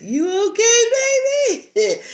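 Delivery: playful Jane Smith checking on her husband. Pretend. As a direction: tease the husband sweetly as a deadly agent. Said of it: "I'm gonna be Jane Smith".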